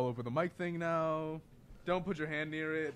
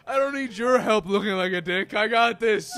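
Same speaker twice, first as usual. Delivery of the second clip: mock defensive; 5 semitones higher, 11 dB louder than usual. Mocking friend as defensive.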